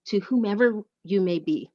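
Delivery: ordinary talk, nothing marked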